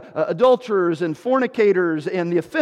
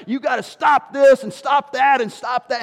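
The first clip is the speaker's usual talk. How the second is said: louder, higher mock preacher harangue; scolding preacher; 5 semitones higher, 4 dB louder than usual. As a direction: imitate a preacher railing against sin. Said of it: "we get on these things and say".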